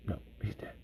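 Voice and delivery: faint, acted last-breath whisper; hushed whisper. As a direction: barely voice it, like a wounded soldier. Voicing the wounded guy at the end.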